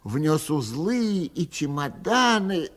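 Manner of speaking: usual speaking voice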